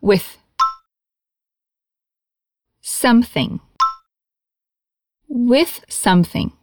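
"With something" said slowly, each TH clear; slow demonstration pace; slow model phrase. Shown as a demonstration. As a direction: spell out "With something" slowly for the learner.

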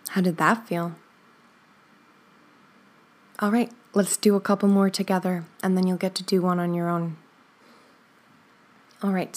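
normal talking voice

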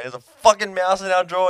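high, loud panicked call; panicked yell. Retold or imitated. Telling his flatmate about the mouse, high panicked yell. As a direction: shout it across the house in alarm.

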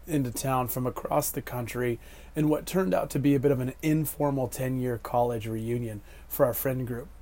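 ordinary talk

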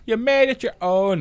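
high, drawn-out talent judge gushing; Louis Walsh. Retold or imitated. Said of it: "I can do just a Louis Walsh".

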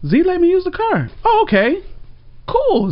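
very high, airy-headed imitation of oneself; naive acceptance. Storyteller voicing what they assumed about borrowing Z's car, naive acceptance. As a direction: act out blithely buying it, pitch way up.

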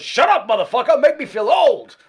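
loud, high, laughing shout of a gruff star; big voice. Retold or imitated. Studio legend yelling at a young player in mock outrage. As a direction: bellow it as the older bassist telling him off. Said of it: "this big voice goes".

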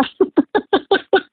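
high delighted laugh; real laugh. Real, not acted. Speaker laughing at meeting Rebecca by chance, high delighted laugh. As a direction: laugh at the coincidence.

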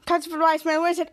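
plain reading voice

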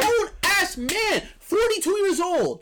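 high, drawn-out indignation; incredulous outrage. Real, not acted. Friend venting about lazy grown roommate, incredulous. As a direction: stretch it out in outraged disbelief.

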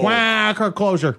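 duck voice. Shown as a demonstration. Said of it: "a quacking danger hole".

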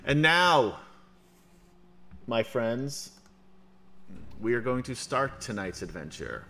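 big announcer opening. Acted. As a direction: present the night's adventure grandly. GM announcing the adventure.

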